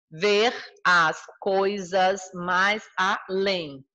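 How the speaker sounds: very slow "ver as coisas mais além", broken into separate syllables. Shown as a demonstration. Teacher dividing a verse for the class, syllable by syllable. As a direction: scan "ver as coisas mais além" slowly, each syllable set apart. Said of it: "Aqui é meio gramaticalzinha".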